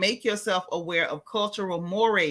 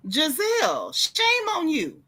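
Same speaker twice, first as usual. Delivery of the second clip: scolding; 8 semitones higher, slower than usual. Real, not acted. Scolding a cast member.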